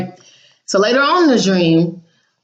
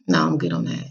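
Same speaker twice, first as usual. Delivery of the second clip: much lower and softer, a dry brush-off; quiet dismissive quote; 7 dB quieter than usual. Retold or imitated. Brushing off the miserable family. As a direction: mutter it low.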